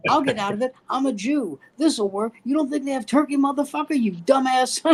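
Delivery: high-pitched mock pleading turning to insult; mock his clever plan, half laughing; mocking ridicule. Young draftee behind mocks the man who asked about ham, jeering.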